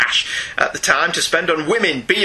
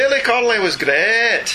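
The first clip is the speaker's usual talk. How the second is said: higher-pitched mock Scottish comedian; Billy Connolly; 5 semitones higher than usual. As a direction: put on a hammed-up Scots brogue. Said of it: "you're doing a Billy Connolly".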